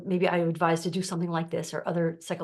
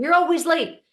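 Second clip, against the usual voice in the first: loud, high, accusing bark at an employee; accusing boss; 10 semitones higher, 8 dB louder than usual. Retold or imitated. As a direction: play the irritated boss scolding you. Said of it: "your boss is saying".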